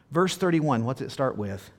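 ordinary talk, nothing marked

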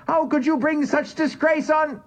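high, wailing cry of outrage and pain; howling in outrage. A narrator's reading. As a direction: howl it out, pained and furious. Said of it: "The voice was howling".

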